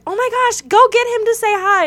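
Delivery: high excited squeal of producers; mock excited gush.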